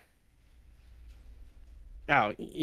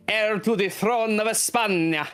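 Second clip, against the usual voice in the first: put-on hammy Spanish accent, España rolled; 7 semitones higher than usual. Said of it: "Equally terrible, I'm sure".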